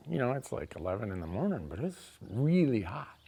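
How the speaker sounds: ordinary delivery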